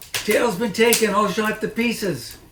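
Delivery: shrill it down the stairs as the mistress; Mrs Bean's voice. Acted. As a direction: Mrs Bean calls down, high and loud. Mrs Bean calls from upstairs.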